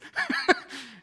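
high burst of laughter; the pastor's real laugh after the handshake story; hearty laugh. Real, not acted. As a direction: laugh at your own joke.

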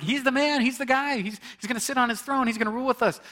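high, loud, thrilled hype; excited disciples. Retold or imitated. Disciples hyping up Jesus as king, loud and excited. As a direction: cheer him on like a champion.

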